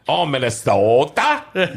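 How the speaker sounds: slow, drawn-out Minnesota "Oh"; exaggerated Minnesota accent. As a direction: stretch it like a Fargo caricature. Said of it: "That's, that's not how we talk".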